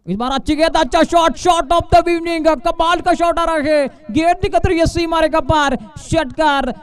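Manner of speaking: rising to a big shout for the six; excited cricket commentary. Real, not acted. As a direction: yell the six home. Six hit, commentator erupts.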